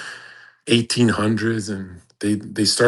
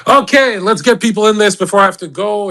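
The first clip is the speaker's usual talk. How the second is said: much higher and louder, calling out; sudden loud switch; 11 semitones higher, 7 dB louder than usual. Real, not acted. Streamer abruptly calling callers in before leaving, loud and high. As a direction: snap into host mode and call them in. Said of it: "switches to calling in people".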